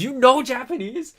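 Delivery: high, loud and slowed astonished question; shocked question. Retold or imitated. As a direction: stretch it out in utter disbelief. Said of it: "Which means".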